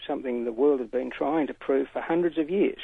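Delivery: ordinary delivery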